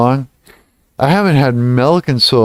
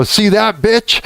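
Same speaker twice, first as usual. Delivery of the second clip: higher gloating taunt; mock taunt; 9 semitones higher, slower than usual. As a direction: rub it in, pitched up. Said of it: "And I go".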